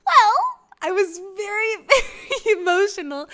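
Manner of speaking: high, choked-up mock weepy voice; mock tearful. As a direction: squeak it out as if about to cry. Mock-tearful account of breaking down.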